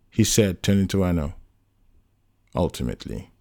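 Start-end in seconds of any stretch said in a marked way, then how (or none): 2.5-3.3 low, quiet, grave answer; solemn. A narrator's reading. Anno answers John's one-word question, solemn. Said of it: "Anno said in a solemn tone".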